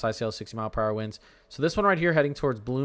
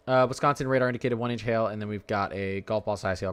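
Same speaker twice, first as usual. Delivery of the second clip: usual speaking voice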